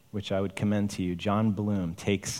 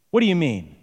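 hostile question; 7 semitones higher, 6 dB louder than usual. A narrator's reading. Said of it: "The tone sounded more like, "Get out of my sight".

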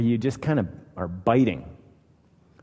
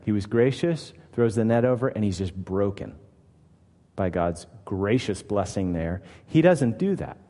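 normal talking voice

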